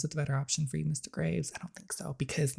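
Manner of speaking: soft, unvoiced taunting whisper; whispering a cruel dig at the lonely father; menacing whisper. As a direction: lean in and hiss the jab. Cold taunt to Mister Graves.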